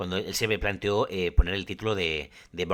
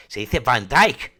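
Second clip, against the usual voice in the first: "Van Dyck" como "fan daik", a la neerlandesa y lento; acento neerlandés; 3 semitones higher, 9 dB louder, much slower than usual. Retold or imitated. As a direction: imita al amigo neerlandés diciendo "Van Dyck" despacio. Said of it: "Y dice". ("Van Dyck" con pronunciación neerlandesa)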